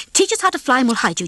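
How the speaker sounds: fast, urgent bargain; quickly. Acted. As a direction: rattle off the offer fast. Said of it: "Ginger said quickly".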